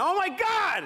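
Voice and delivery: high, panicked gasp of alarm; alarmed cry. Retold or imitated. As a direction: yelp it high on finding the dog. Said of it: "and I went".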